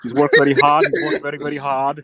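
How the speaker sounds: mock Liverpool accent; put-on Scouse accent; Tony Bellew. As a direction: imitate a Scouser's sympathetic post-fight remarks. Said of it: "Tony gonna be like".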